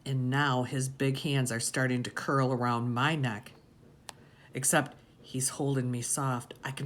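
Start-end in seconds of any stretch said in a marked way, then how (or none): none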